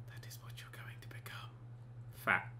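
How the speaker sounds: very soft, voiceless whisper; ominous whisper. Acted. Player warning a Sim of its future, whispered. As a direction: whisper it like a grim prophecy.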